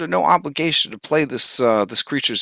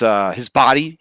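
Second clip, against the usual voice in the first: ordinary talk, nothing marked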